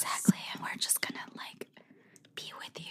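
soft teasing whisper close to the mic; mock ASMR whisper. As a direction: whisper gently like an ASMR host. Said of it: "give them a little A S M R situation".

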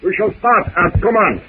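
put-on high, excited French accent; rally the crew in a high French accent; excited French captain.

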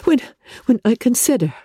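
high, halting, tearful; agitated Elizabeth. Acted. Elizabeth confessing her guilt to Darcy, agitated. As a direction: stumble over the words in distress. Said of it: "she added in a yet more agitated voice".

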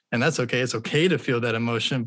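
normal talking voice

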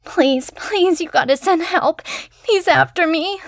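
put-on high, panicked pleading girl; terrified young woman. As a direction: beg for help in panic. Terrified caller begging for help.

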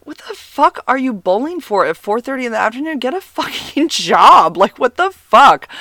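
half-laughing exasperated rant at him; mock outrage. Retelling her outraged reaction.

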